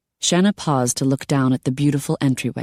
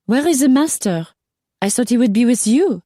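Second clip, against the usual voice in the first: put-on very high, squealing French accent; high-pitched Frenchwoman, French-accented; 10 semitones higher, 4 dB louder than usual. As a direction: demand it in a shrieky French accent. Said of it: "her French accent became more apparent".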